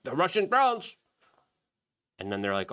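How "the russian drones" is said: mock alarm. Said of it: "Zelensky was like".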